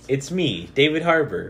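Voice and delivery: put-on loud, high Russian accent; booming Russian-accented announcement. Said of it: "Someone else do a Russian accent".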